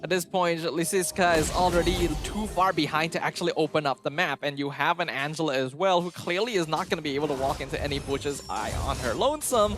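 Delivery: ordinary talk, nothing marked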